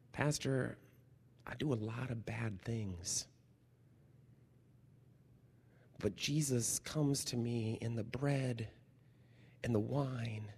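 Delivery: put-on soft, hushed little girl's voice; a child softly confiding to the pastor. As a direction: tell it quietly like a small child confiding. Child's quiet confession.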